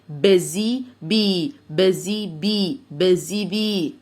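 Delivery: بزي بي with a long, stretched بي, slowly; long e in bee. Shown as a demonstration. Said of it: "اتنين اي بنطقهم اي جامد". (بي ممدودة)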